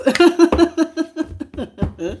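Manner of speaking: loud bright cackle; teasing laugh. Real, not acted. Teasing viewers about J P flirting, loud laugh.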